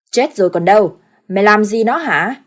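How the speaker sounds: loud furious reprimand; angry scolding. Acted. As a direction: chew out the fool. Father scolds son.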